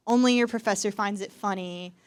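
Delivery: ordinary delivery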